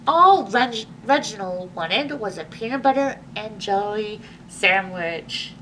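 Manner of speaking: ordinary delivery, nothing marked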